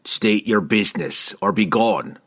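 gruff challenge from the rooftops; threatening. A narrator's reading. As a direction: demand it like a wary guard. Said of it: "demanded a threatening voice".

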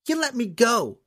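put-on high, defiant young girl voice; girl's retort. A narrator's reading. Captive girl defies the huge man, high and defiant. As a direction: spit back at him like a fearless young girl. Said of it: "she retorted".

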